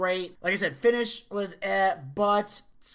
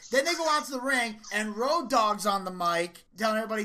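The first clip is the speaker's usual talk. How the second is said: normal talking voice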